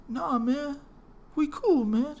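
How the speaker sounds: high, meek backing down; smooth it over in a put-on high voice; mock placating. Guy backs out of the fight, meek high voice.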